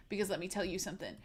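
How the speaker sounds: usual speaking voice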